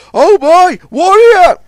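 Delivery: high, loud alarmed cry; a startled hoot at a speeding tug. A narrator's reading. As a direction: cry out as danger speeds closer. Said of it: "Big Mac hooted".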